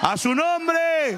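high, slow praise call; high, drawn-out call to praise. Acted. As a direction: stretch it out high, calling the church to praise. Preacher calls for praise.

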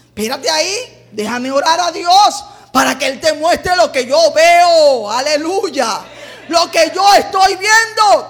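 climbing to a high, loud shout; rousing preacher's crescendo; building preaching fervor. Acted. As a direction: build from firm request to proclamation. Preacher builds quote to climax.

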